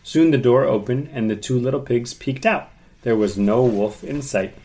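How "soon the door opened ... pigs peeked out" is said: plain reading voice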